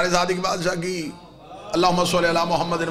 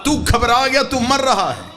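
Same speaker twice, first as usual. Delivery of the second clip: high, loud, building sermon climax; full-force preaching; 8 semitones higher, 6 dB louder than usual. Real, not acted. Preacher telling congregation death is a birth, full-force preaching. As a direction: drive each phrase out to the whole crowd, raised and forceful.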